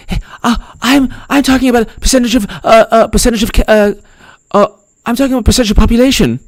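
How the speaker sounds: lightly mocked reporter, no real Australian accent; mocking the journalist. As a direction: fumble the question like a flustered interviewer. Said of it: "I'm not even trying to do an Australian accent".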